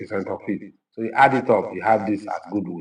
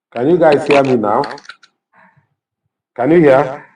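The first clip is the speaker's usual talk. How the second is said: loud mic check, raised voice; calling out loudly; 3 semitones higher, 7 dB louder than usual. Real, not acted. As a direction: call out to check they hear. Mic dead, checking audience.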